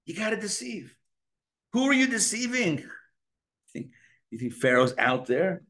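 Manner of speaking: usual speaking voice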